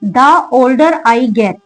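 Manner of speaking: very slow, each word spaced out; teacher reading an English example slowly. Shown as a demonstration. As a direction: read the model sentence slowly, word by word. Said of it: "जैसे".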